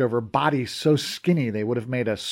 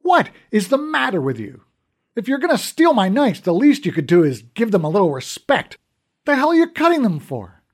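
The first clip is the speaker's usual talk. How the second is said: higher, louder angry tirade; 7 semitones higher, 5 dB louder than usual. A narrator's reading. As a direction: scold the thief hard. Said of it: "I was mad".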